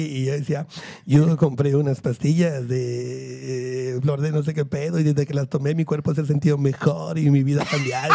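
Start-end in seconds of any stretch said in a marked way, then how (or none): 1.1-8.1 slow, much lower, put-on spiritual drawl; mock testimonial. Mimicking how he mocked the guest, slow low drawl. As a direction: parody a miracle-pill testimonial voice. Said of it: "el vato lo remedaba".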